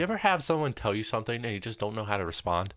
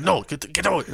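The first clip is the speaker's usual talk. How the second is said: mock angry guard; 6 semitones higher, 4 dB louder than usual. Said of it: "And he got angry".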